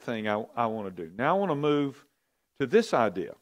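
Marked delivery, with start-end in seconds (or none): none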